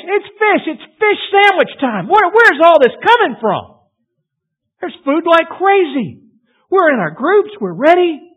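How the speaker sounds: very high, loud, gleeful shouting; excited crowd. Acted. Crowd in the ranks shouts about the fish, high and loud. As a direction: cheer like a hungry crowd finding fish.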